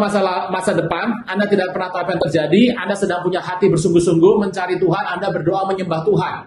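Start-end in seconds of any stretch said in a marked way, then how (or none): none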